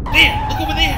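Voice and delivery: loud, high alarmed yell; panicked cry pointing at the road ahead; frantic shout. Acted. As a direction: shout it out to make her look. Driver sights the phantom again.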